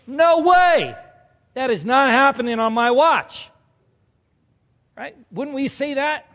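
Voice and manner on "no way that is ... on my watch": protesting shout. Acted. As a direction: refuse it outright. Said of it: "And Peter goes".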